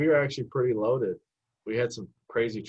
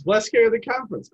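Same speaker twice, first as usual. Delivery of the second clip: much higher, louder punchline; incredulous topping; 12 semitones higher, 7 dB louder than usual. Real, not acted. Correcting his friend, high and incredulous. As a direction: pile on the absurdity.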